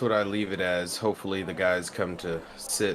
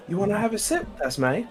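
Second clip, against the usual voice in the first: higher, friendly Aussie-ish barkeep; chummy barkeep voice; 13 semitones higher, 4 dB louder than usual. Acted. Bartender chatting up a stranger at the bar, high and chummy. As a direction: play the chatty bartender calling everyone mate. Same character voice.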